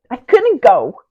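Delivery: "couldn't" cried out high and loud; anguished insistence. Acted. Sister could not face the cross, anguished insistence. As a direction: burst out on "couldn't".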